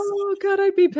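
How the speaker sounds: high, loud outrage; a real burst of high indignation; outraged. Real, not acted. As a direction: exclaim it, appalled and angry. Outraged at cake smashing.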